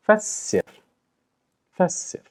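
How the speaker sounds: "Fassir" with a held s, pitched high; doubled s. Shown as a demonstration. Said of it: "It should be fassir".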